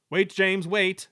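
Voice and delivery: loud, urgent warning shout; urgent call. Acted. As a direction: call out to stop him. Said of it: "said his driver".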